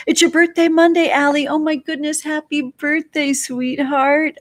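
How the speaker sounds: light up and gush the birthday wish; high excited happy-birthday gush; excited birthday greeting. Real, not acted.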